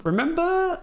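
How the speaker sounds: "remember" squeaked very high on one sustained note; squeaky remember. Acted. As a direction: stretch "remember" up into a comic squeak.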